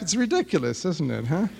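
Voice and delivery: ordinary talk, nothing marked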